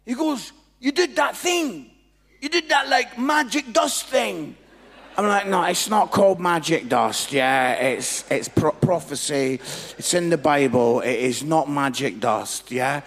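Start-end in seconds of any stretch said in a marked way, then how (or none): 0.8-4.5 loud, high-pitched, stretched ending; eager recollection. Retold or imitated. Guy reminds speaker of the prophecy, loud and eager. 5.5-13.0 very loud, insistent correction; indignant correction. Retold or imitated. Speaker corrects magic dust to prophecy, loud indignant insistence.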